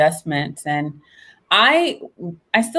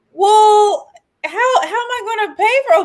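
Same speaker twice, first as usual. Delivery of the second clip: retold alarm; 14 semitones higher, 5 dB louder than usual. Her own retold alarmed reply.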